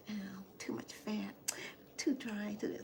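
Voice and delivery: whispered, mocking fussy eaters; mock complaining. Imitating picky eaters, whispered mock complaint. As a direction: sneer it under your breath. Mimicking people who complain about food.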